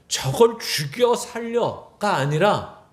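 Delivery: normal talking voice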